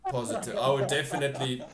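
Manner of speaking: breaking into laughter mid-role; laughing through it. Acted. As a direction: lose it while in character.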